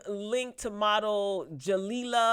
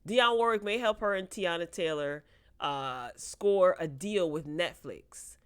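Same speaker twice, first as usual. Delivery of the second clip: usual speaking voice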